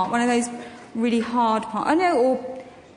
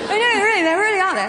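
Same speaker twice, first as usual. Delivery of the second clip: high, louder ironic protest; mock posh disbelief; 9 semitones higher, 5 dB louder, faster than usual. Actress teasing the audience about posh ladies, mock outraged. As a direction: ham up the shock that posh people exist.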